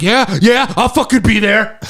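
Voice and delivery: loud, high mock fury; imitating dad losing it. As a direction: mimic a father yelling back in rage. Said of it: "My dad is losing it".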